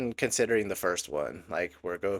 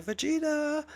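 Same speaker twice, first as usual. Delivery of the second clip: imitating a much higher, yelled call of his name. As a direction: shout his name across the battlefield. Bulma calls out to Vegeta.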